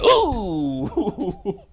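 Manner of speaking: cooing ooh of dazzled fans; mock swoon. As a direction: swoon like everybody did. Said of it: "everybody was like".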